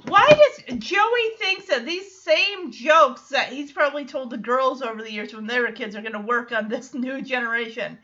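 normal talking voice

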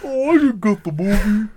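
high put-on whining voice; hammed-up high whine; mocking whine. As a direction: whine it like a mocked child. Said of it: "Anytime you do the bullshit with".

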